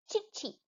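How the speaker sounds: high, soft cik cik; teacher chirping for the class; bird chirp imitation. Shown as a demonstration.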